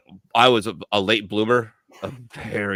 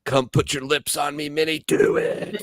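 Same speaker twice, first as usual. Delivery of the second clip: tempting cigarette voice; 5 semitones higher than usual. Pretend.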